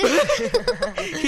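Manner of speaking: mocking laugh at the fallen chair; burst of laughter. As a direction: laugh out hard and high.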